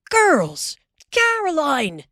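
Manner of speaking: father calling his family home. Acted. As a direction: call them in, excited. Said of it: "he called".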